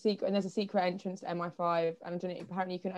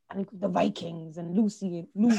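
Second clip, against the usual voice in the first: put-on Icelandic accent. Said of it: "speaking like this".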